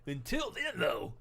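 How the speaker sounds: cartoon voice. Attempting a cartoon voice.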